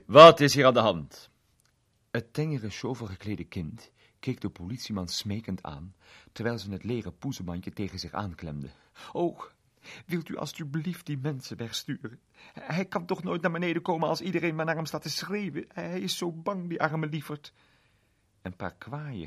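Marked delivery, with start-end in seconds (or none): 0.1-1.3 loud, firm question; policeman's demand. A narrator's reading. Policeman arriving at the crowd, loud and firm. 9.1-17.5 put-on higher, anxious little girl begging; pleading girl. A narrator's reading. Small girl begging the policeman to send the crowd away, higher and anxious.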